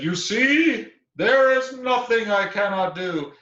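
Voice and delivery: loud, high triumphant bear voice; boastful bear. Acted. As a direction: crow from the top of the tree.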